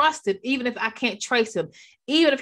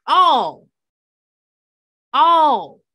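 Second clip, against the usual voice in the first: "all" shouted out, much louder; emphatic shout; 4 semitones higher, 9 dB louder than usual. Shown as a demonstration. Preacher shouting all at the congregation, loud. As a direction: belt "all" twice to the saints. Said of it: "look at that word again".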